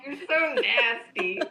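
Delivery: slow, laughing scolding; mock disgust. Quoting her friend's texts about the pictures, slow mock disgust. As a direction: stretch it out, teasing through a laugh. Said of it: "She always texts me back".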